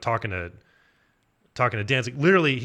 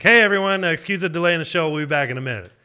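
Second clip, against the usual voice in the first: mock announcer; 6 semitones higher, 6 dB louder than usual. Promoter addresses crowd.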